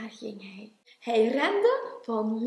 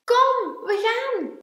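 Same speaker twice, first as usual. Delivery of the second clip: high, loud, eager call; 11 semitones higher, 8 dB louder, slower than usual. A narrator's reading. Klein urging Groot to set off, calling out. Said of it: "riep Klein".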